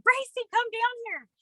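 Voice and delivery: high sing-song call up the stairs; calling her daughter down. Retold or imitated. Mom calling daughter downstairs.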